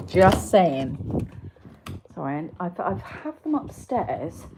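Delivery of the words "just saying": smug aside. Real, not acted. Smug aside after biscuit fact.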